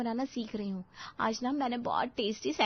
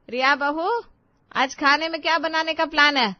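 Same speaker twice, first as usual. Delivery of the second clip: higher, much louder call across the house; 6 semitones higher, 9 dB louder than usual. Acted. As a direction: summon the bride from another room. Mother-in-law calls bride from afar.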